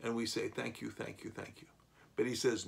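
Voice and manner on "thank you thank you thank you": soft, fading into a whisper of thanks; hushed thanks. Retold or imitated. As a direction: breathe the thanks, barely audible. Grateful believers answering Jesus.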